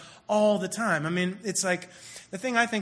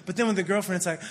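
usual speaking voice

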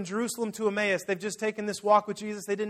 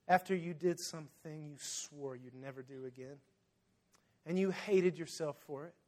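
ordinary talk, nothing marked

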